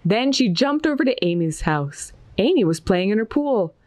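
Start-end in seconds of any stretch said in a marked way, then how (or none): none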